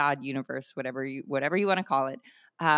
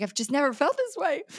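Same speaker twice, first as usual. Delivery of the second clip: higher, choked-up retelling of her friend's joy; tearful quote; 10 semitones higher, 3 dB louder than usual. Retold or imitated. Friend overwhelmed with joy, retold with mock tearful lift. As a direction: echo the friend's happy tears, voice lifted. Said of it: "And she's crying".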